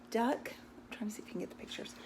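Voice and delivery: quick whispered aside to the viewer. Real, not acted. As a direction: whisper it fast, off to the side. Aside while showing pictures.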